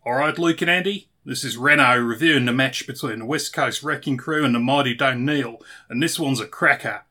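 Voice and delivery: put-on loud, broad Australian accent; exaggerated Australian accent, projected loudly; Australian cousin Reno. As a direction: put on a big Aussie voice for a match review. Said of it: "hand over to my Australian cousin Reno".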